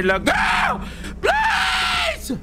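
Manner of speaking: shrieking high in panic; terrified screaming plea; scared horror game streamer. Real, not acted. Panicked scream at ghost girl.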